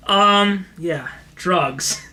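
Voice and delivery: usual speaking voice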